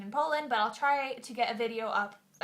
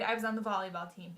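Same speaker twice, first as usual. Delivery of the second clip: ordinary talk, nothing marked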